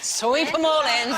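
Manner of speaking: loud, slow comic sweep; gleeful grabbing. Shown as a demonstration. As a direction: stretch it as if scooping up loot. Acting out greedy grab of freebies.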